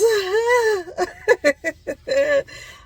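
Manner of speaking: high, loud burst of laughter. Real, not acted. Vlogger cracking up at own wail, loud laugh.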